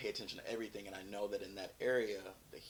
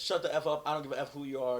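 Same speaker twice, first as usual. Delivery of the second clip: louder, higher angry order; retold yelling; 4 semitones higher, 5 dB louder, faster than usual. Officer dismissing the off-duty cop, retold yell. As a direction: raise your voice to imitate a yelled command. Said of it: "he's yelling at me".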